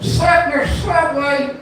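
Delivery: Seffner blown through the teeth, slow and high. Shown as a demonstration. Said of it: "blow it through your teeth for full effect".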